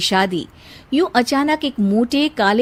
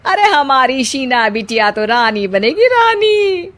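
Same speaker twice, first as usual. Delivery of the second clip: boastful aunt; 2 semitones higher, 5 dB louder than usual. A narrator's reading. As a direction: brag about the groom's family like a proud aunt. Aunt boasting about groom.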